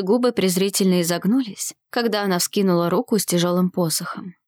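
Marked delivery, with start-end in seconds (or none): none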